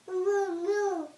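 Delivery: put-on high mooing for a toddler; squeaky cow moo; playful moo. Animal noise for the baby.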